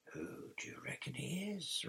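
soft, unvoiced-sounding stage whisper; hissed whisper. A narrator's reading. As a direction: whisper the question so the sleeper won't hear. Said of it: "Ron hissed".

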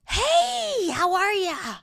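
high, loud, sing-song customer-service voice; mock the pushy cheerful clerk; over-the-top friendly American sales greeting; gushing American shop assistant. Mocking overly friendly US shop assistant.

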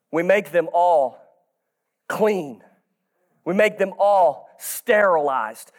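normal talking voice

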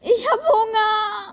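drawn-out theatrical wail of misery; dramatic whining. Acted. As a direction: wail it like a tragedy, half laughing. Acted chat, dramatic complaint.

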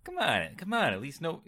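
high, bright Lori Petty voice; Lori Petty impression. Shown as a demonstration. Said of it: "I was trying to do Lori Petty".

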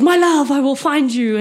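hammed-up lover's vow to the sky; dramatic vow. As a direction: shout it to the universe. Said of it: "very dramatically".

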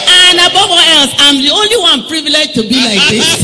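high, loud, laughing excitement; excited shouting. Real, not acted. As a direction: shout it out with joy. Excited shouting after the cheer, laughing.

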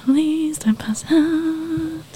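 whispering. Real, not acted. Whispering during breath-hold contest.

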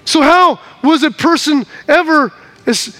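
ordinary delivery

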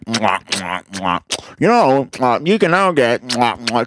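smack your lips like a cringy mayor eating; lip-smacking mouth-full chewing parody; mock eating noises. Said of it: "He's like".

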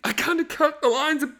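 high, guilty, stammering confession; sheepish confession. Retold or imitated. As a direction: squeak out the guilty admission. Woody confessing to his friends.

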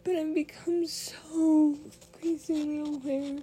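fighting back tears while talking; holding back tears. Real, not acted. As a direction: voice breaking, high and loud. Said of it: "I'm trying not to cry right now".

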